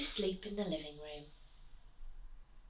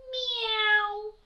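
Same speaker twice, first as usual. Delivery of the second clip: high, long, loud miaow; drawn-out cat call; yowling cat; 14 semitones higher, 6 dB louder than usual. A narrator's reading. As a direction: sing it like a cat on a wall. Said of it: "sang the cats on the wall".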